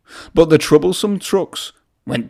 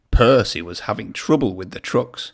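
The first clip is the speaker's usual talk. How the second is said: ordinary delivery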